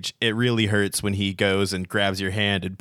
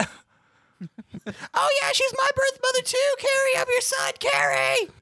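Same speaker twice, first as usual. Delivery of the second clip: mocking fan falsetto; slower than usual. Mock fan claiming her.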